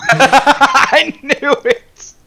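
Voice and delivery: slow, high, laughing words. Real, not acted. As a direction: drag it out while laughing. Player laughs at insight check.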